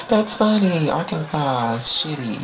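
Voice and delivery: squeaky high voice; high comic voice. Acted. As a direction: stay up in a squeaky pitch. After own squealing laugh.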